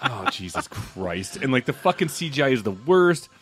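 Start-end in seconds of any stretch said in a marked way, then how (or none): 0.0-1.4 low, slow mock dismay; weary "oh no" reaction, dragged out; retold dismay. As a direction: draw it out low and soft, appalled. Groaning reaction to bad movie.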